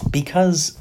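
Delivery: usual speaking voice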